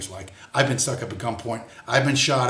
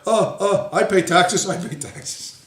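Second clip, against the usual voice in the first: higher, stammering mimicry, fading out; higher flustered mock protest; mocking the kid; 6 semitones higher, 4 dB louder, slower than usual. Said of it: "And he's like".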